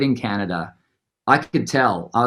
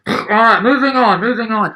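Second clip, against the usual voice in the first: higher, brisk brush-off; dismissive stage manager; 9 semitones higher, 3 dB louder than usual. Retold or imitated. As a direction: shrug off the band, move the show along. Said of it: "And he went".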